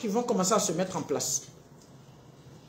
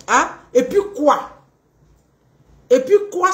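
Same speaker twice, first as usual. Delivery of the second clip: louder, challenging and high-pitched "et puis quoi"; a partner challenging back defiantly; defiant retort; 4 semitones higher, 6 dB louder than usual. Acted. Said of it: "les réponses de ces personnes".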